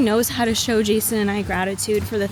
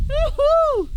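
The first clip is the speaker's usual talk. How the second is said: high whooping cheer; excited cheer; 3 dB louder than usual. Real, not acted. Cheering during push-ups.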